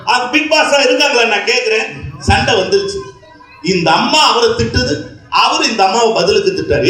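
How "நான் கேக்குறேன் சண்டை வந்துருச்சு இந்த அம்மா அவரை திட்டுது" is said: ordinary talk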